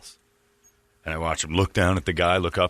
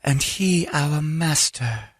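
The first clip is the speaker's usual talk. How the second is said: high, slow devotee voice; reverent woman impression; 8 semitones higher, much slower than usual. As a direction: imitate her revering her master, drawn out. Voicing the pimp's woman.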